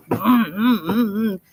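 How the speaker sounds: hummed car-engine revs; imitated engine hum. Sister acting out starting the car, imitated engine noise. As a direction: hum short pulses like a motor.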